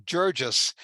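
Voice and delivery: Jurgis with a soft G, as in "gem". Shown as a demonstration. Author passes on a German professor's advice, soft-G Jurgis. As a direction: pronounce Jurgis as JUR-jus. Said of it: "with a soft G".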